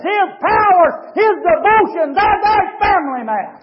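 high, loud, rising sermon cadence; shouted hellfire-and-brimstone delivery; full-force preaching. Acted. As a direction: preach it hard to the whole church. Preacher builds to climax.

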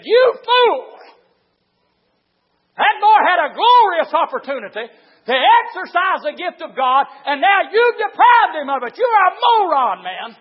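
loud, high taunt from the devil; accusing devil. Acted. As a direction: berate the preacher as his accuser. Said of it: "the devil jumped up there and said".

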